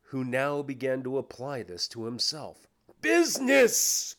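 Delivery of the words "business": high, loud ghostly outburst; anguished cry. Acted. Ghost throws Scrooge's word back, crying out. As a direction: cry it out, stretched and indignant. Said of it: "cried the ghost".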